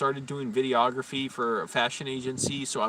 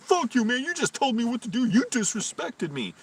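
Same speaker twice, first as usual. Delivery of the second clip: much higher-pitched mock outrage; indignant retort; 11 semitones higher than usual. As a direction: imitate an offended coworker protesting hotly. Coworker's angry retort acted in quarrel.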